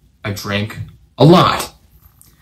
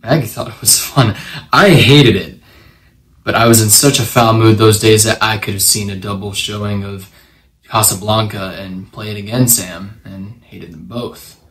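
subdued confession; 3 semitones lower, 6 dB quieter than usual. A narrator's reading. Widowed ex-marshal confesses his guilt over his wife's murder, subdued. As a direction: let the guilt sink in, softly and haltingly.

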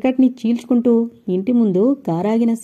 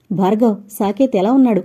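usual speaking voice

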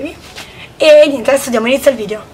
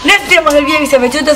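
fast outro; 3 semitones higher, faster than usual. Real, not acted. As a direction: rush through the goodbye. Rushed outro to viewers.

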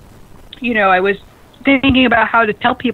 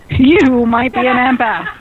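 slow punchline. Shown as a demonstration. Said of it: "here's one for you".

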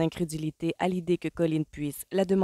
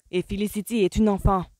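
blurted retort; 5 semitones higher, 6 dB louder than usual. A narrator's reading. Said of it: "lâcha-t-il".

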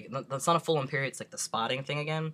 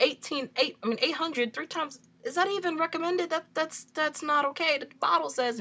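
high-pitched disbelief; 14 semitones higher than usual. Retold or imitated. Questioning the nurse about the dose, high and alarmed. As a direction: voice jumps way up in alarm.

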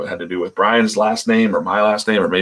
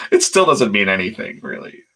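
ordinary talk

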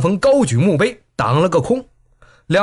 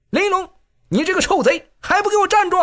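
high scolding yell; 14 semitones higher than usual. A narrator's reading. Ren Feiyan scolding fleeing Lin Yulong, high shrill yell. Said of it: "转头骂道".

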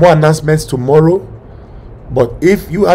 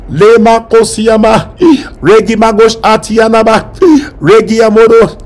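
tongues; 3 semitones higher, 4 dB louder than usual. Acted. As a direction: pray in tongues fervently. Speaking in tongues.

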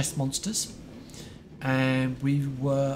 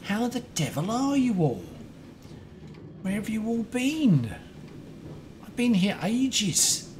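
overexcited; 7 semitones higher than usual. Real, not acted. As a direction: greet everyone in a high, excited voice. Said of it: "I'm just obviously overexcited".

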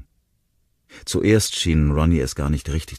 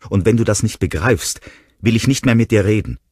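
louder and higher ultimatum; crying out; 7 semitones higher, 7 dB louder than usual. A narrator's reading. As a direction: call out the threat. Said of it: "rief sie".

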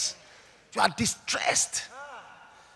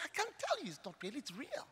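much softer, quick sorrowful answer; hushed reply; 7 semitones higher, 15 dB quieter than usual. Retold or imitated. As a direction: quietly admit the hard truth. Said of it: "I said".

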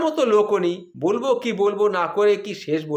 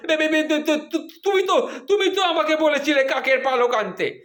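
higher stuttering stammer; 9 semitones higher than usual. Retold or imitated. Husband stammers back at angry wife, higher nervous stutter. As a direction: falter and stutter like a scared husband. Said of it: "আমতা আমতা করে বললাম".

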